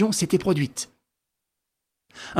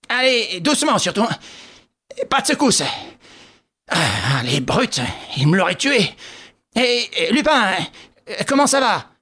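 put-on worried old policeman; 5 semitones higher than usual. Ganimard voiced, worried about Lupin.

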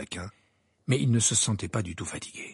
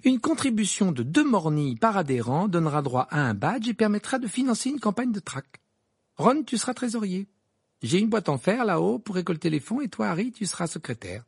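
higher, busy organiser's cheer; cheerful Hermione; 8 semitones higher than usual. A narrator's reading. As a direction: hand out the jobs gladly. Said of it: "d'un ton joyeux". (ton joyeux et décidé)

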